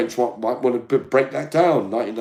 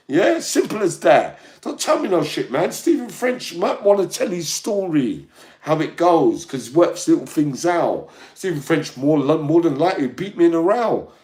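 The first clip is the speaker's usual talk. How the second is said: loud, raised, heated rant; angry rant; 6 semitones higher, 4 dB louder than usual. Real, not acted. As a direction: keep hammering the point, loudly.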